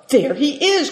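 high, delighted exclamation; joyful cry. A narrator's reading. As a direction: spot him and cry out happily. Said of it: "cried Seven Stars joyfully".

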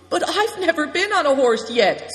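high, loud objection; worried protest. A narrator's reading. Big Tiger has never been on a horse, protesting. Boy protests about mounting.

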